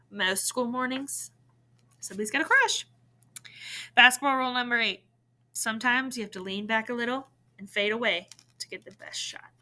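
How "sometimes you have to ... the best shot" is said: ordinary delivery, nothing marked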